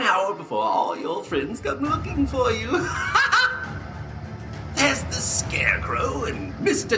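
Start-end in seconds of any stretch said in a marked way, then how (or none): 2.8-4.7 high maniacal cackle; evil cackle. Acted. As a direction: cackle with glee. Joker taunting captive.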